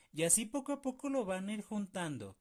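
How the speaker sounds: usual speaking voice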